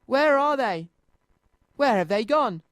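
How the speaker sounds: a toy finding his car empty, worried; worried cry. A narrator's reading. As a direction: look around in a fluster. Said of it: "he cried".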